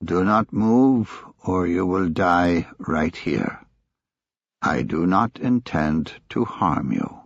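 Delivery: put-on slow, menacing Chinese accent. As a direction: threaten slowly in a Chinese accent. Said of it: "a voice with a heavy Chinese accent".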